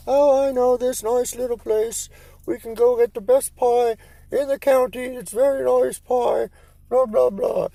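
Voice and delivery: put-on very high, prim old English lady voice. Buddy's old English mom chirping about pie, very high and prim. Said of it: "she's like".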